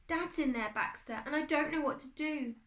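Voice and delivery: high, helpless plea; distraught. A narrator's reading. Boy telling his beetle about Dad, distraught.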